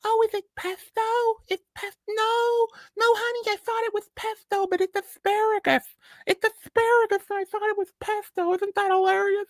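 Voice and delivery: extremely high falsetto mock woman; Bill Burr's brunch lady. Retelling Bill Burr mocking a brunch woman, in shrieking falsetto. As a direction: coo it like a giddy brunch lady. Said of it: "He's like".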